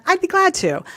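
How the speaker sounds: high, cheerfully willing lilt; positive tone. Shown as a demonstration. Showing the inflection she taught her kids, brightly positive tone. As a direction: sing out a very agreeable yes. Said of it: "it's a positive tone".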